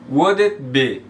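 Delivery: "would it be" linked, clear d into "it"; "would it be" with a silent L; model pronunciation. Shown as a demonstration. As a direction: say "would it" as "wud-it". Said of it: "você só pronuncia o U".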